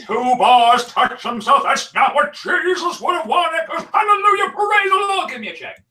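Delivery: high, loud hammed-up preacher; televangelist. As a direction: bellow hallelujah like a TV preacher.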